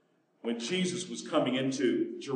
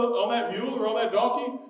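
usual speaking voice

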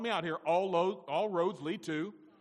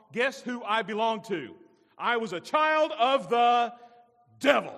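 louder and slower toward devil; preacher's rising punch line; building to a shout; 3 semitones higher, 8 dB louder than usual. Acted. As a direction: swell up and land hard on devil.

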